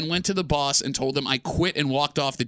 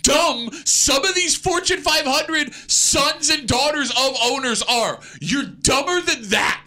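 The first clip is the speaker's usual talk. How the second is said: loud, drawn-out, higher-pitched berating; exasperated rant; 10 semitones higher, 4 dB louder, slower than usual. Real, not acted. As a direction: hammer home each insult, slowly and loudly. Heated rant berating the co-host.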